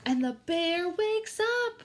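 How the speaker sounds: loud dramatic announcement. A narrator's reading. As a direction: boom the big reveal. Dramatic turn in story.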